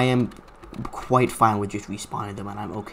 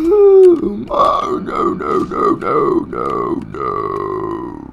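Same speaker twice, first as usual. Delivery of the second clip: loud panic fading low; panicked; 11 semitones lower, 8 dB louder, much slower than usual. Real, not acted. Chased in game, panicking.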